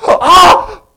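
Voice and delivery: loud, high imitated crowd cry; crowd gasp. Preacher imitating the entire crowd watching the shot, loud gasp. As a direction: gasp loudly like a stunned crowd. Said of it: "hear the entire crowd go".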